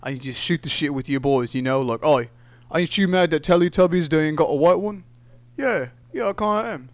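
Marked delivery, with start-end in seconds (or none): 2.0-6.9 mock Cockney accent. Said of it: "speak in Cockney accents like Michael Caine".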